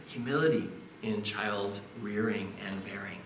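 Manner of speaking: grace explained in a whispered aside. Real, not acted. Preacher's confidential aside to the mothers, whispered. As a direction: breathe it out, no voice.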